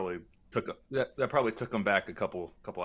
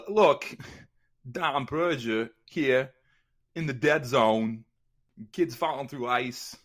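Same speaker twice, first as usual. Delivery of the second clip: Christopher Walken impression; 3 semitones higher, 4 dB louder, slower than usual. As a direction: play Walken with lurching pauses, tongue in cheek. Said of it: "working on my Christopher Walken impression".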